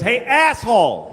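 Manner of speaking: slow, loud mock yell; imitating angry yell. Angry driver shouting through the open window, mock yell. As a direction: drag out the insult like the angry driver. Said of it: "And he goes".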